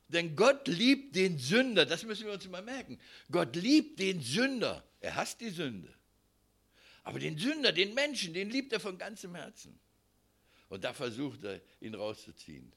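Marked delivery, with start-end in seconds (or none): 0.1-9.8 fervent preaching. Acted. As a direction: proclaim God's love. Preaching at full force.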